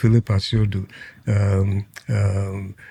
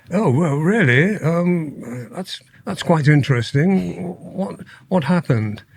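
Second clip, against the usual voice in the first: higher-pitched, imitated Queen's English; Prince Charles's accent; 6 semitones higher than usual. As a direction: put on a royal British accent, drawing out the first words. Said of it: "in his accent, because they have this sort of accent".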